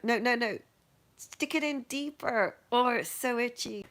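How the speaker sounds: mock eager pleading. Retold begging for deeper needle.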